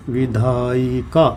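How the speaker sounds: slow, stretched-out "विधायिका" with the syllables separated. Shown as a demonstration. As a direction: draw out each syllable of "विधायिका" for the class. Teacher spells out the example word.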